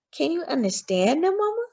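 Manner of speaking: put-on a small boy asking in awe; curious child. A narrator's reading. Frederick asking his mama at night, high soft child voice.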